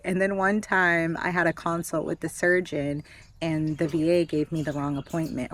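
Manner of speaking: normal talking voice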